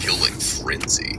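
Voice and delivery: slow, whispered game announcer call; Halo announcer impression. Would-be voice actor playing around: whispered announcer voice. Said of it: "you could be the fucking Halo announcer".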